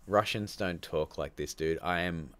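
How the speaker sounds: ordinary delivery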